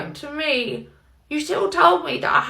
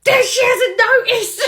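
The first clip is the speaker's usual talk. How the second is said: gleeful laughing; 5 semitones higher, 8 dB louder than usual. Acted. Gleeful about prank.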